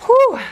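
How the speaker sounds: a high, loud breath of relief after effort; relieved whew. Real, not acted. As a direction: blow it out like you just finished the set. Exhale after side plank set.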